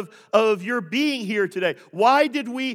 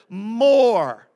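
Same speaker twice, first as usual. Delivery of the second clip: "More" stretched out long, with full weight; emphatic MORE; 4 dB louder than usual. Real, not acted. Preacher says underline it, circle it, "More" drawn out emphatically. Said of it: "Underline it".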